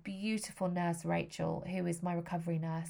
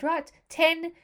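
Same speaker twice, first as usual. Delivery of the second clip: South African anaesthetist; 9 semitones higher, 7 dB louder than usual. Retold or imitated. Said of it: "very broad South African as well".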